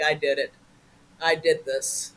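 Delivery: a hint of dismay at himself; rueful self-blame. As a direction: quietly own the mistake. Sees homeless on his face.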